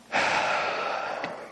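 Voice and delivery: long, heavy sigh of giving up; exasperated sigh. Acted. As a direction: breathe out slowly, worn down. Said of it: "at some point you just".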